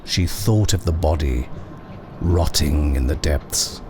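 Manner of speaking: usual speaking voice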